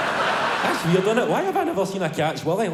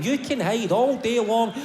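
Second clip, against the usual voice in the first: ordinary talk, nothing marked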